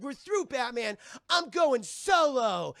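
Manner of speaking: high, loud, slow declaration; angry storm-off. Retold or imitated. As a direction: spit each word like a sulking teen. Robin quitting angrily.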